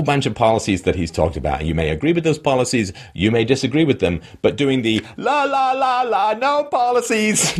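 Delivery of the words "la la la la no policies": high, slow mock la-la chant; mocking singsong. Mocking critics who deny Trump's policies, high singsong. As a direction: sing it like a child plugging their ears.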